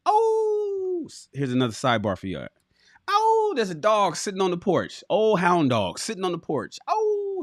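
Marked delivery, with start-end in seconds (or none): 0.1-1.0 long high hound-dog howl. Retold or imitated. Telling viewers about the dog on a nail, howling. 3.1-3.5 high, drawn-out hound howl; dog howl. Retold or imitated. Setting up the porch dog story, howling. 6.9-7.4 long high hound-dog howl. Retold or imitated. Telling viewers about the dog on a nail, howling. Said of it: "and he's howling".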